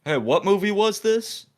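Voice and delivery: high, dopey mock question; lightly mocked dim voice; clueless coworker. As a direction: play the clueless coworker who can't see the obvious. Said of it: "he looks at me and goes".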